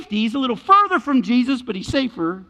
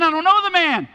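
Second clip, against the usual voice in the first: loud, high acted protest; protesting denial; 9 semitones higher, 5 dB louder than usual. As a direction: deny it forcefully, pitched up high. Peter denies it again, acted.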